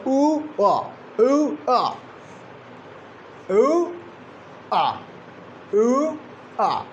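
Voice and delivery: "u, a" as "oo", then "uh"; separated diphthong parts. Shown as a demonstration. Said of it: "movement from one position to another".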